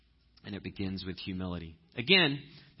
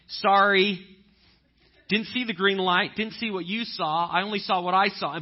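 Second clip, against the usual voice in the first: sheepish apology; 9 semitones higher, 10 dB louder than usual. Retold or imitated. Apology wave to driver.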